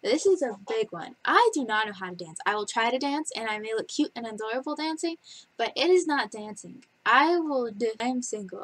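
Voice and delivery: ordinary delivery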